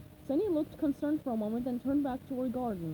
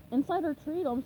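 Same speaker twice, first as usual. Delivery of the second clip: plain reading voice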